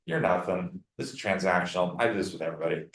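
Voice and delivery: low and soft, flatly waving it off; a mock awkward shrug; the transactional wrong answer; dismissive brush-off. Shown as a demonstration. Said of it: "It's awkward".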